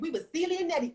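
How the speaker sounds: ordinary delivery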